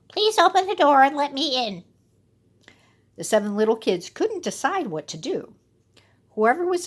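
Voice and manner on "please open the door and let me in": wolf's put-on sweet mother voice; soft sweet voice. A narrator's reading. Wolf coaxing the kids at the door, sweet and high. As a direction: coax them to open up. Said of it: "in his soft, sweet voice".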